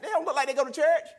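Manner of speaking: loud, very high exasperated cry; retold upset protest. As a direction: wail the injustice to God. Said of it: "I get upset with him".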